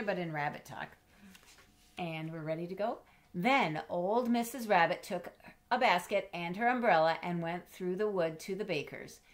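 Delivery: ordinary delivery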